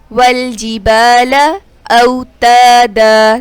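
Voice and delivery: اوتادا with the alif after ت stretched; slow tajweed, holding the long a in اوتادا; waw leen and alif madd. Shown as a demonstration. Teacher modelling the madd in اوتادا, slow chant. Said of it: "الف مدہ ہو رہا ہے".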